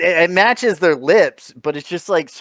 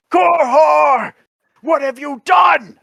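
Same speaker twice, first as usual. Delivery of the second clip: much higher, loud anguished cry; mock shout; 12 semitones higher, 5 dB louder, slower than usual. His parody redub, a high loud mock cry. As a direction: cry out the name in hammy despair. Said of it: "I wanna change it to".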